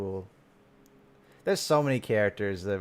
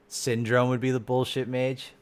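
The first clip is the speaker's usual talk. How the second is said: ordinary talk, nothing marked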